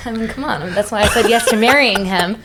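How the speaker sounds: delighted, laughing as you gush; laughing, playful justification. Real, not acted. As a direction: talk through real laughter. Laughing while gushing about fiancé.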